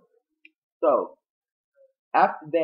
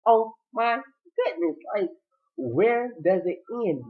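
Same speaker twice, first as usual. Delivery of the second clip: mock dismay; 7 semitones higher, slower than usual. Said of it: "She's like".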